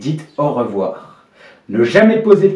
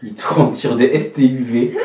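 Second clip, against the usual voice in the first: ordinary delivery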